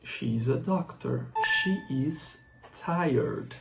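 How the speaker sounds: slow, clear model sentences; slow repeat-after-me. Shown as a demonstration. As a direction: say each one slowly for students to copy. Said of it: "Repitam comigo". (devagar, para os alunos repetirem)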